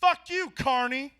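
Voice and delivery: slow, mocking drunk woman's voice; drunk lady sneer. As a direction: spit it slowly at the worker. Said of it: "looked me dead in the eyes and goes".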